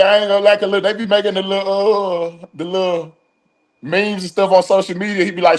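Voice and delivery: ordinary talk, nothing marked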